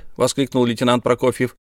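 plain reading voice

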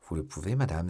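ordinary delivery

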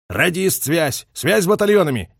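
moderately shouted military command; called-out order. A narrator's reading. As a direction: call urgently to the radio operator. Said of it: "крикнул Гуляев".

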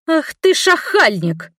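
high and slow, indignant; fly up in offended fury; nun outraged at the leering old man; outraged cry. A narrator's reading. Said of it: "взвилась Мерле".

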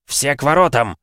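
loud, high call to all; strangled urgent order. A narrator's reading. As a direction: raise the alarm, urgently. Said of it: "сдавленным голосом проговорил он".

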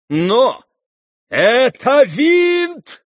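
high, drawn-out accusing cry; loud, slow outburst of outrage; outraged shout. Acted. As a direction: shout it out, stretching each word. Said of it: "вскричал генерал".

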